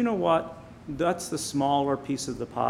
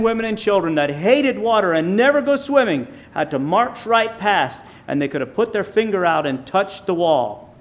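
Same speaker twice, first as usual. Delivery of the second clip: loud, high preaching voice, stretched on key words; impassioned preaching; 6 semitones higher, 7 dB louder than usual. Real, not acted. Preacher telling of the walls of water, impassioned. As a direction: preach it out with raised pitch and force.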